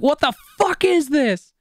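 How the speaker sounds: high, slow, disgusted What the fuck; a retold shriek of disgust, stretched out; shocked outburst. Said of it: "I'm like".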